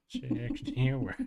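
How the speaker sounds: SIXTY-ONE as a mushy "shixty-wuh"; silly slurred number. Pretend.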